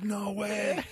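put-on high, thrilled girl's "No way"; a hint of a thrilled child; excited little girl. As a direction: voice the girl's disbelief, lightly.

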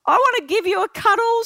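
high, affectionate imitation of a star; Oprah impression. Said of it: "she then goes".

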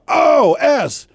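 high, loud burst of recognition; excited recognition. Retold or imitated. Host retelling how he recognized a singer in an elevator, mock excited. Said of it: "I go".

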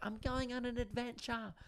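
high, soft put-on voice; tiny voice full of false grandeur; mock adventurer. Playing up a trip to the bin in a high soft voice.

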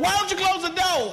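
high, loud mock shouting; husband's angry yell. Husband blaming spouse over mosquitoes, exasperated shout. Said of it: "the whole husband said".

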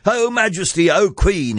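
high, proclaiming address to royalty; crying out. A narrator's reading. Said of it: "he cried".

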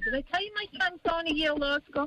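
high, fast, showing-off voice; mock bragging. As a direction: brag like a proud name-dropper. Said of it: "My, big name dropper".

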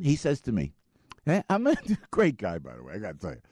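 ordinary delivery

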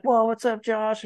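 very high-pitched surfer hello, imitated; excited greeting. Acted excited greeting from surfer friend.

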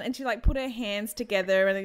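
ordinary talk, nothing marked

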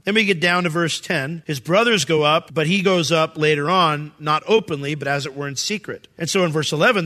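ordinary talk, nothing marked

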